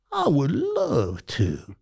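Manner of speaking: put-on slow, eager old-man reply; imitating Reggie. Reggie accepts the daily movie-review slot, slow and eager. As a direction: savour each word like a gift.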